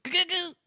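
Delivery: high, loud hiccupy noise; imitated country cackle. Mocking how country folk end sentences, high squawky noise. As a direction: mimic a squawky sentence-ending yelp. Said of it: "they always end every sentence with".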